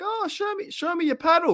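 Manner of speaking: high, mock voice; squeaky mock-eager request; mock older pickleball player. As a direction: pitch way up, teasing an eager old player. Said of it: "they're gonna be like".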